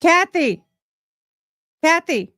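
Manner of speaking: loud calls of her name, high and carrying; calling out. Real, not acted. Calling out loudly to Kathy across the room to get her attention.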